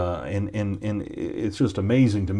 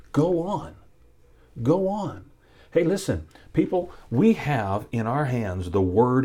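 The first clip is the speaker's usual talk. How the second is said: fervent preaching. Acted. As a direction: proclaim God's word with conviction. Preaching climax.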